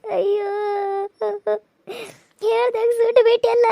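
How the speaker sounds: high, tearful, sobbing reproach; ಅಳುತ್ತಾ ದೂರುವುದು; crying reproach. Acted. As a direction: cry out the blame. Daughter crying, refusing to talk.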